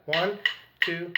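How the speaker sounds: soft, evenly spaced count-in; measured beats, one word each; rhythmic count-off. Real, not acted. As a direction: count the drummers in on the beat. Counting in before playing.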